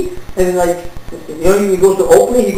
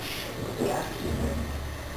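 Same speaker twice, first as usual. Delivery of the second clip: hushed whisper, slowed down; whispered panic; 21 dB quieter, much slower than usual. Retold or imitated. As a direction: breathe it out as a worried secret. Panicked aside to himself.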